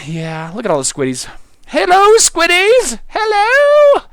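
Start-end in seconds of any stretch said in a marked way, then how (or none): 1.7-4.0 very high, loud, drawn-out hello; mock-sweet squealed shout to little creatures; calling out. Calling out to squids in game.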